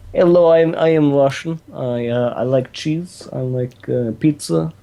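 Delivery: deadpan Russian tourist introducing himself; Russian accent. Shown as a demonstration. Showing off a Russian accent to her co-host. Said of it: "I can do a mean Russian accent".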